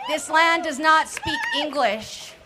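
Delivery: rally cry, loud and slow; defiant shout. Real, not acted. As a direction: raise your voice and declare it to the crowd. Rally speech, declaring to crowd.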